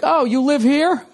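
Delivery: drawn-out, unsettling creepy driver; high, slow creepy voice of a driver. Retold or imitated. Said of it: "something really creepy like".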